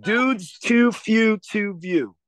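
slow drawn-out Baltimore accent attempt. Shown as a demonstration. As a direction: try out a Baltimore accent, very slowly. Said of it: "I really wanna be able to do the Baltimore accent".

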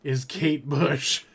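slow, drawn-out words; mock disbelief. Host teasing about a famous singer, mock incredulous drawl. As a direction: drag it out as mock ignorance.

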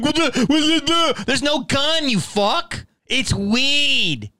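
imitating the third cop.

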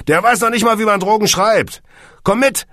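high, loud jovial ribbing; boisterous. A narrator's reading. Steven mocking his pal before dragging him off, boisterous. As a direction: crow it out at full voice. Steven jokes, drags Kunze along.

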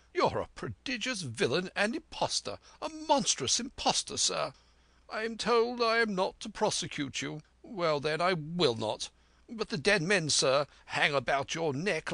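a gentleman squire scolding a villain, voice climbing high; indignant squire. A narrator's reading. Squire rebukes Silver to his face, indignant and high-pitched.

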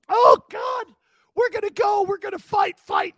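blubbering pep talk yelled through mock tears; Marty Schottenheimer in tears. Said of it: "Here's Marty in tears".

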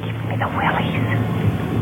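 whispered, slow and uneasy; hushed shiver of unease; creeped-out whisper. Acted. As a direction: whisper it so he won't hear. Creeped out by customer arriving.

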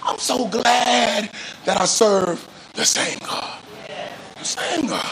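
high, impassioned preacher's cry with drawn-out words; fervent preaching. Real, not acted. Preaching at full force.

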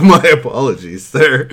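loud, high, overly polite apology; bow to a bush, drunkenly formal; mock polite drunk. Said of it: "And I was like".

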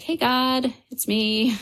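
high, chatty greeting to God; casual prayer. Retold or imitated. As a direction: call up lightly, pitched high. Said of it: "I'm saying my prayers".

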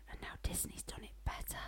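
hushed, whispered dig; whispered aside. Pretend. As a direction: drop right down into a whisper. Cheeky aside about Disney.

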